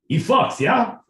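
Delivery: lightly voiced Russian bragging; Russian accent. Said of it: "Russian, my boy Pete".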